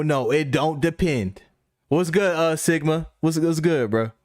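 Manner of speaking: ordinary talk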